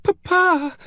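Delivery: put-on high, gasping child's voice; very high, panicked child's cry; gasped cry. A narrator's reading. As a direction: gasp it out as a frightened little girl. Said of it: "Eloise gasped".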